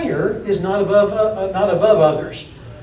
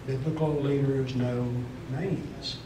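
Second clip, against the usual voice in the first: "Biblical leaders know" dropped lower and softer, almost to itself; 4 semitones lower, 10 dB quieter than usual. Shown as a demonstration. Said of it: "biblical leaders know personal stories".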